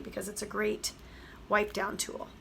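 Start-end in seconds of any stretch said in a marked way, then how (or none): none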